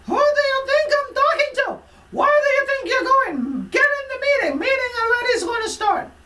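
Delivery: high screeching yell at a coworker; high, loud imitation of a screaming supervisor; mock angry scream. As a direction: scream orders like a furious boss.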